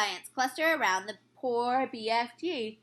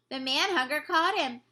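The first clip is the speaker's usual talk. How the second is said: ordinary delivery, nothing marked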